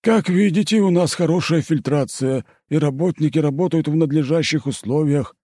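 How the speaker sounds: high, eager fawning official; oily, eager-to-please voice; fawning manager. Acted.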